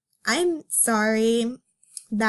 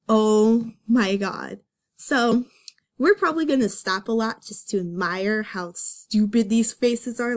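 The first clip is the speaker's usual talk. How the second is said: usual speaking voice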